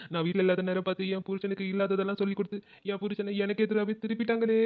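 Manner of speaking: put-on sobbing, words drawn into a whine; fake crying. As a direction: sob theatrically to win husband over. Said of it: "அழாத சின்ன பொண்ணு". (போலி அழுகையோடு புலம்பல்)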